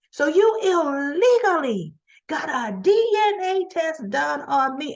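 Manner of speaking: high-pitched, scandalized accusation; indignant outrage. Retold or imitated. Accused mother confronting woman over DNA test, indignant outrage. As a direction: act out her outrage, pitch jumping up.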